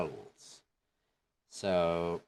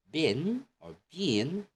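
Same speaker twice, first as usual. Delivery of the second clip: British "been" stretched long like bean; English accent; 8 semitones higher, 4 dB louder, slower than usual. Shown as a demonstration. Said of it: "In an English accent".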